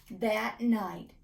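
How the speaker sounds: plain reading voice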